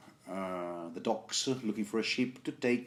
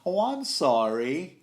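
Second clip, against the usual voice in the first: drawn-out sarcastic "oh, I'm sorry", higher and louder, slow; sarcastic apology; 7 semitones higher, 6 dB louder than usual. Retold or imitated. Podcaster voicing the assassin's sarcastic apology before striking. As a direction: oversell the apology you don't mean. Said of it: "maybe so sarcastic".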